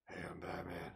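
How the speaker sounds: low gravelly whispered Batman. Pretend. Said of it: "whisper in my gravelly voice".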